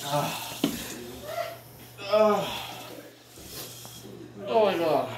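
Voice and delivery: pained from the hot pepper; talking through a burning mouth; mouth burning from spicy food. Real, not acted. Just ate a spicy pepper.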